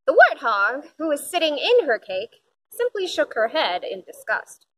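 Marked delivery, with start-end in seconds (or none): none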